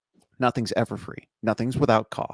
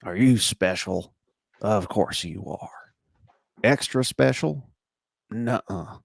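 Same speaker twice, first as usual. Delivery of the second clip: gravelly voice; slower than usual. Retold or imitated. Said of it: "he's got this grizzled, gravelly old voice".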